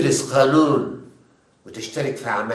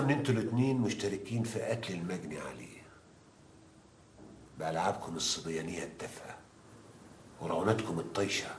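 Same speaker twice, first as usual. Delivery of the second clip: hushed stern lecture; 7 semitones lower, 14 dB quieter than usual. Acted.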